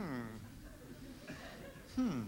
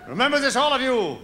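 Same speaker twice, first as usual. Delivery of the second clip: mock German; much slower than usual. Comic German lecturing audience.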